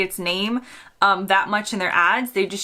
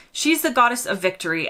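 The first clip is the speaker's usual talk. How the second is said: usual speaking voice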